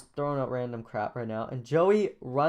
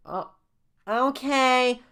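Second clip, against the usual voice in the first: put-on very high, loud kid voice; high-pitched, half asleep; 11 semitones higher, 8 dB louder, much slower than usual. Jaden woken by Miss Walker, extremely high-pitched. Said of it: "extremely high-pitched voice".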